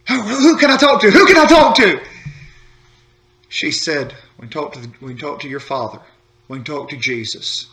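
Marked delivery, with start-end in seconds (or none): 0.1-2.0 mock-desperate, higher-pitched pleading question; exasperated demand. Discouraged pastor asking his wife who to talk to, exasperated. 4.4-7.7 soft, low, hushed reply; gentle answer. Retold or imitated. Wife telling upset pastor who to talk to, gently.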